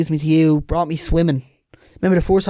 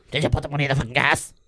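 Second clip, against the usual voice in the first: a hint of a gruff dad, softer; dad's voice; 5 dB quieter, faster than usual. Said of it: "Who's that voice you're doing?".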